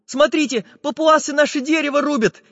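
high-pitched alarmed cry; Chizhik frightened. A narrator's reading. Small friend interrupts with a warning, frightened. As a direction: pipe up in fright. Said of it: "испуганно прервал их Чижик".

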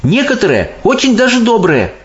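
put-on high girl voice insisting; indignant Sophie. A narrator's reading. Sophie defends grown-ups to the giant, indignant. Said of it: "возмутилась Софи".

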